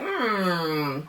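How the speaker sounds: high, drawn-out doubtful okays; skeptical okay. Retold or imitated. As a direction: pull back in disbelief. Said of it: "I was just like".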